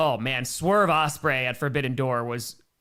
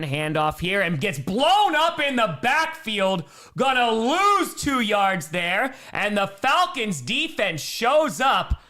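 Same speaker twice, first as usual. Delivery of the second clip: excited sportscaster call, louder and higher on the big hit; rising football commentary that settles at the end; live play-by-play; 5 semitones higher, 5 dB louder, slower than usual. Pretend. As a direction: call the play, stretching the key words. Calls the play as it happens.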